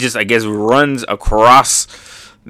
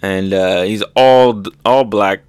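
ordinary talk

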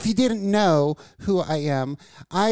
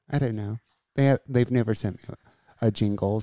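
normal talking voice

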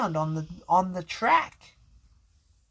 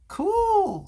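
high, loud delighted; excited cool; 4 dB louder than usual. A narrator's reading. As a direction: squeal it with delight. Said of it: "said Ace".

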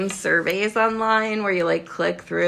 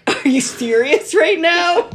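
higher, slow, laughing disbelief; incredulous; 10 semitones higher, 6 dB louder, slower than usual. Retold or imitated. Cohost reacting to a weird survey, incredulous. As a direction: draw it out in mock disbelief. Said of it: "You were just like".

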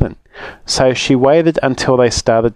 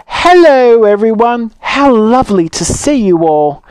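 much higher, louder delighted greeting; cheerful Grandma; 11 semitones higher, 5 dB louder than usual. Acted. Grandma arriving at her party, delighted and high. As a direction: greet them all with a beaming smile. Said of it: "with a big smile".

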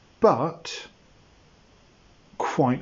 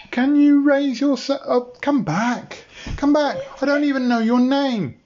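higher, pleading call to come back; calling after her; 12 semitones higher than usual. Acted. Bob calls after the leaving dinosaur, higher and pleading. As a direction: call after the retreating creature.

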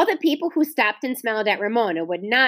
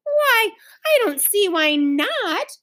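high, surprised and slow; surprised mother; 10 semitones higher, slower than usual. A narrator's reading. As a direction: sound caught off guard. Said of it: "Mother sounded surprised".

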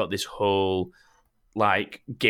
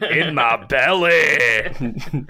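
drawn-out, higher Scottish character cry; fake Scottish accent; 4 semitones higher, 4 dB louder, much slower than usual. Showing off to co-hosts, fake Scottish accent. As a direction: stretch it out in a hammed-up Scottish accent. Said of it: "with my fake Scottish accent".

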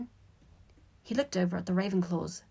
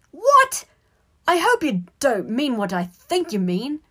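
loud, high shocked outburst; shrieked question, then loud indignation; indignant; 7 semitones higher, 10 dB louder than usual. A narrator's reading. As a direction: challenge an insinuation, voice raised. Harry indignant at Ron's insinuation.

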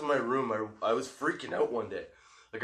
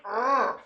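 short strangled growl, higher and louder; frustrated growl; 11 semitones higher, 5 dB louder than usual. Retold or imitated. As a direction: bottle up the rage into one growl. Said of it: "You have to kind of be like".

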